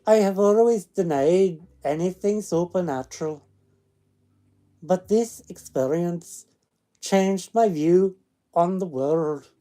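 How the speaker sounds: loud storytelling in a put-on Scottish accent; attempted Scottish accent. Streamer reads a ghost story to listeners, attempted Scottish accent. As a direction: read it out in an amateur Scottish accent. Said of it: "I could do a Scottish".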